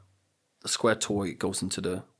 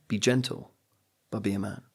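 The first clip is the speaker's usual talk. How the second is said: normal talking voice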